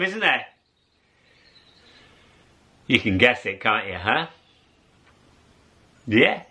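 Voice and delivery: ordinary delivery, nothing marked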